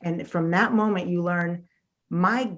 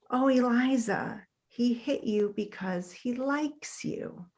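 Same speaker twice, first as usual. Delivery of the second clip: soothing mom; 4 semitones higher, 7 dB quieter, slower than usual. Retold or imitated. Mom soothes crying little girl.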